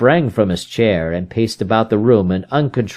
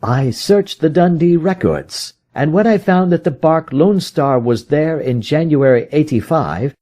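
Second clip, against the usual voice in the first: higher, brisk detective voice; Holmes, explaining his search; 6 semitones higher than usual. Acted. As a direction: lay out the trail.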